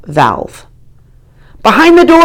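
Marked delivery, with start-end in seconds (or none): 1.6-2.2 high, loud shout; shouting directions to the janitor. A narrator's reading. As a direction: yell over the water. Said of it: "I shout".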